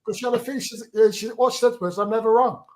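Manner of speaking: high and loud through laughter; bubbling over with laughter; laughing excitedly. Real, not acted. Laughing about the cleaning lady.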